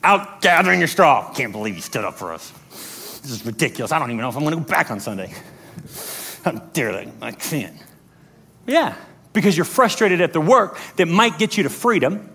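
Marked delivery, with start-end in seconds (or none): 1.3-7.8 low, very soft muttering; grumbling complainer. Acted. Complaining Israelite gathering straw, low grumble. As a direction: grumble under the breath, affronted. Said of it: "You'll be the straw complaining Israelite".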